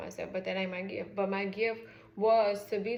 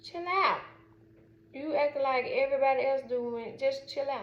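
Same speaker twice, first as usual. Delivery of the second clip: higher, louder telling mom to chill; scolding daughter; 5 semitones higher, 4 dB louder than usual. Retold or imitated. Daughter tells excited mom to chill out, louder scolding. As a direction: scold her to calm down.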